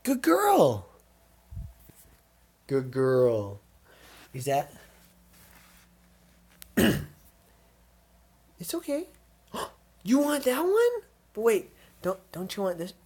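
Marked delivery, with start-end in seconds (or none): 0.0-0.9 high pet praise. Real, not acted. Owner praises dog for choosing, high cooing voice. 8.6-12.9 very high, gentle coaxing; coaxing pet voice. Real, not acted. Owner coaxes dog toward a treat, high cooing voice.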